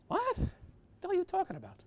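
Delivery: sharp, high "what the hell" in disbelief; high retold yelp of disbelief; incredulous outburst. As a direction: blurt it out like a boss who can't believe it.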